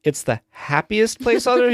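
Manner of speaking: high questioning lilt; imitated puzzled interviewer; retold interviewer's correction. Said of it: "the person who's interviewing him is like".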